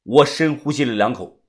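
usual speaking voice

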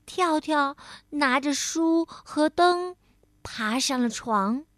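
usual speaking voice

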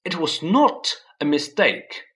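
angry. A narrator's reading. Harry angrily insists to Uncle Vernon. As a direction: insist hard in anger. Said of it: "said Harry angrily".

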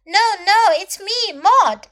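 high, loud protest; insistent correction. A narrator's reading. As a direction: insist on who you are. Character insists on who she is.

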